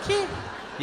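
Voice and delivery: high startled "¿Qué?"; shocked what. Acted. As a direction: blurt it in disbelief. Shocked boyfriend reaction.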